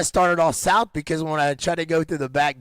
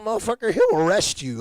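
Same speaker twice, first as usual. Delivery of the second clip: excited retold outburst; 7 semitones higher than usual. Retold warning to security guard.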